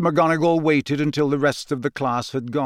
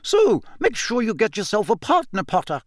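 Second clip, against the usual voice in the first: higher, forceful schoolmistress; stern lecture; 9 semitones higher than usual. A narrator's reading. McGonagall orders Harry to find a partner, stern lecture. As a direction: remind him of his duty. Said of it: "McGonagall lecturing Harry".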